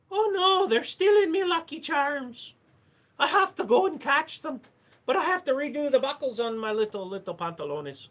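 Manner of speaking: very high, loud squeaky leprechaun. Acted. Leprechaun panicking at Lucky Charms thieves, high and loud.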